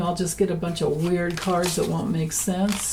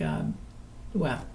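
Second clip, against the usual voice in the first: ordinary talk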